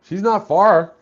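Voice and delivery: usual speaking voice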